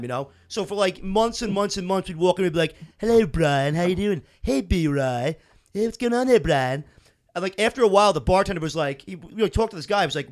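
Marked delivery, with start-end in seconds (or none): 3.0-6.8 put-on cartoon character voice; Stewie. Buddies greeting each other at the bar, Stewie impression. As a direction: imitate a cartoon voice greeting a pal. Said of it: "we would always do Stewie all the time".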